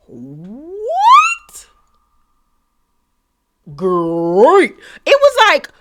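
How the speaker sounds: high, loud, incredulous what-the; stunned disbelief. Real, not acted. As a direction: squeal in shock at the jump from calm to a threat. Reacting to Kimmy's threat.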